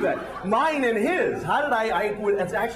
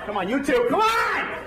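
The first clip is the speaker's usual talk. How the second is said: mock shout; 7 semitones higher than usual. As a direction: push the stragglers along. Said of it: "urging the others to join".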